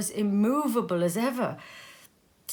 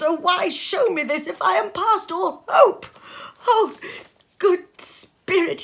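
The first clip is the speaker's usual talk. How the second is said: loud, much higher, sobbing entreaty; desperate crying plea; 8 semitones higher, 11 dB louder than usual. Acted. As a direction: clutch its robe and beg through tears. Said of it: "he cried, tight clutching at its robe".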